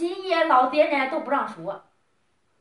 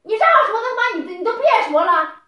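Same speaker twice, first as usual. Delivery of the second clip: higher and louder, furious; angry shout; 8 semitones higher, 10 dB louder than usual. Real, not acted. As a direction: shout him down in anger. Heated quarrel, cutting him off.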